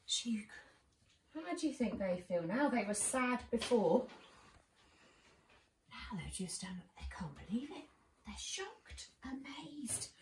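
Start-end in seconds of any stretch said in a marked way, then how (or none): 5.9-10.1 hushed, breathless wonder; telling children of the stunned parents in a whisper; awed whisper. Acted. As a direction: whisper it like it's too amazing to say.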